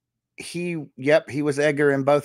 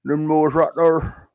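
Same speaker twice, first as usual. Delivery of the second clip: hammed-up weird Canadian accent, louder; mock Canadian accent; 2 semitones higher, 4 dB louder than usual. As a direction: put on a joke Canadian accent. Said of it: "from Canada or something with some weird accent".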